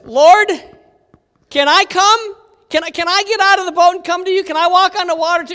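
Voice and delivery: high, loud, fast eager pleading; eager Peter. Retold or imitated. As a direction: blurt it out like a keen kid. Peter eagerly asking Jesus.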